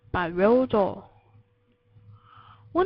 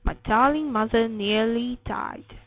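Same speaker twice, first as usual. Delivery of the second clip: plain reading voice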